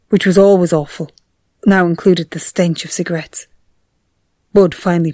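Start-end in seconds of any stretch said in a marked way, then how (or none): none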